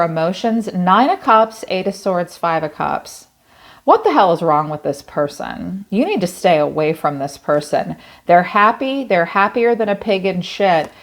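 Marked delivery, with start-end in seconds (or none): none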